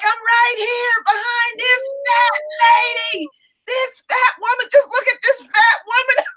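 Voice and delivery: put-on very high, stretched-out little-boy holler; shrieky-high mimicked toddler call; imitating yelling child. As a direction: sing it out high, like a kid announcing to everyone. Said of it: "that's what he yelled out too".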